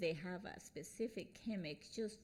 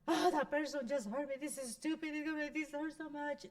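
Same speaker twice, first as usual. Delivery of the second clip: higher-pitched, hammed-up wounded complaint; mock hurt; 8 semitones higher, 4 dB louder than usual. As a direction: wail it like someone wallowing in hurt. Acts out hurt inner self-talk.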